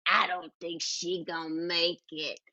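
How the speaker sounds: low, doubtful character voice; judgmental tuna can. Acted. Tuna can judging her emergency supplies, low doubting voice. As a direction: play a tuna can passing grim judgment. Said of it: "my tuna can is very".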